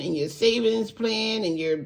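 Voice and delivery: ordinary talk, nothing marked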